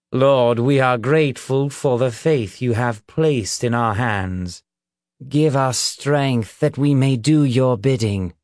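solemn public prayer; preacher's prayer. A narrator's reading. Reverend prays before the crowd, solemn and declamatory. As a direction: pray aloud for the whole crowd, measured and formal.

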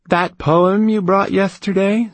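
bored. Acted. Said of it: "said Balbulus in a bored voice".

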